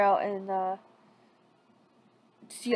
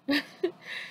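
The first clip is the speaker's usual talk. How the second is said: giggle; 5 semitones higher, 7 dB quieter than usual. Acted. Sakura giggles in the story.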